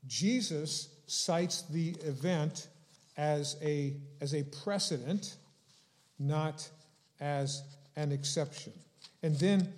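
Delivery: ordinary talk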